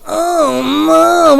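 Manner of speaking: high, loud, drawn-out wail; hammed-up cry of dismay; mock wailing. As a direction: wail helplessly, dragging the words out. Helpless mock wail at climax.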